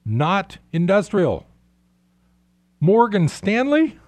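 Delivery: rising incredulous exasperation; exasperated disbelief. Real, not acted. Listing companies, mounting disbelief.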